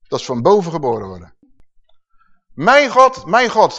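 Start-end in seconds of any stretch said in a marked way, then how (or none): 2.5-3.8 mock cry. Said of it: "Hij schreeuwt tot zijn vader".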